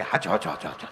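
low, mumbled yes-yes of a meek husband; sleepy henpecked husband nodding along; meek husband agreeing. Retold or imitated. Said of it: "તો ઓલો બેઠો બેઠો કે".

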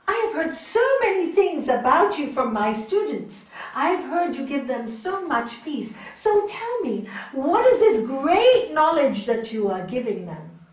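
loudly confronting the master; proud scholar. Acted. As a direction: boom it out like a self-important scholar. Arrogant scholar challenges Buddhist master.

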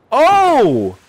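high, loud "Oh!"; surprised shout. Real, not acted. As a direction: yelp in real surprise. Surprise at snowboard trick.

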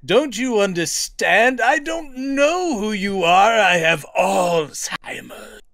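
slow, high, overwrought declaiming; Heston impression. Co-host as Heston in a late-career drama, hammed-up anguish. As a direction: ham it up as an anguished old star. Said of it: "Heston giving the acting his heart out".

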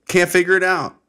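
high, loud burst of frustration; exasperated outburst. Acted. Host acts out not knowing why he's pissed, exasperated. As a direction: throw it out high, at a loss. Exasperated, then resigned repeat.

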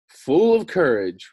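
loud, slow, proud roar of courage; the lion boasts after drinking; bold lion. A narrator's reading. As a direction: swell up boldly like the lion. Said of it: "replied the lion".

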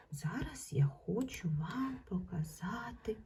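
ordinary talk

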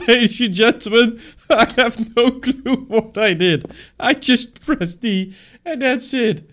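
high, loud showman voice, laughing through it; laughing mock announcement. Real, not acted. Gamer to viewers after a lucky solve, high laughing announcer. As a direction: announce your lucky win like a ringmaster, slowly.

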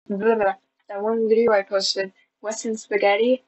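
usual speaking voice

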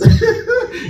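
real high-pitched burst of laughter; high laugh mid-story. Real, not acted. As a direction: laugh out at the memory. Telling a funny story.

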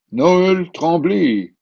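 very slow, loud, drawn-out jolly name; jolly old man. A narrator's reading. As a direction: proudly announce your name like Santa. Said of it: "répondit le joyeux personnage". (réponse lente et joviale du vieil homme)

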